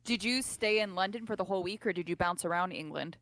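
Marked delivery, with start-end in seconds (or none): none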